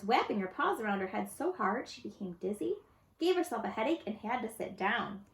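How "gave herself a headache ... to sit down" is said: ordinary delivery, nothing marked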